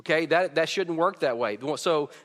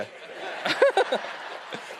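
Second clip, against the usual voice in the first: breathy laugh, way up high; a quick high giggle; high airy laugh. Real, not acted. Kid's answer amuses him.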